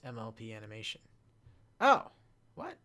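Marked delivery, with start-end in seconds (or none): none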